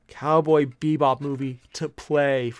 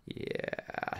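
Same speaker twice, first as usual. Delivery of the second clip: whispered yeah; 14 dB quieter than usual. Real, not acted. Aside about fiancée.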